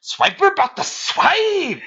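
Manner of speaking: very high, drawn-out cartoon villain line; exaggerated sneaky fox announcing himself; Swiper. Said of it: "That's how he talks".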